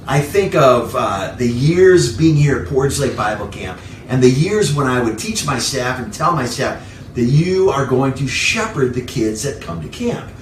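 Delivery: ordinary talk, nothing marked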